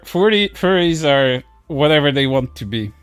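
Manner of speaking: usual speaking voice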